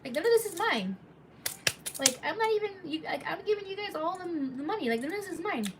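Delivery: high pleading voice, protesting innocence. Retold or imitated. Telling the angry crowd none of this is mine, high and pleading. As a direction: pitch it up high, begging them to believe you. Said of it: "And I'm like".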